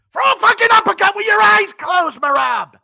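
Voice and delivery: high, very loud frantic coach scream; Matt Serra impression. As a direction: bellow absurd advice in total earnest. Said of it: "screaming in Matt Serra's voice".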